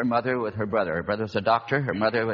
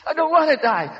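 high, loud pleading wail; desperate cry; 8 dB louder than usual. Retold or imitated. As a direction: cry out in terror. Girl cries out against voices.

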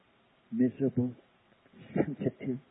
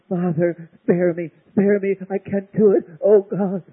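higher and louder, frantic begging; mock begging plea; 6 semitones higher, 6 dB louder than usual. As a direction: play the plea he didn't pray. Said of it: "he wasn't saying".

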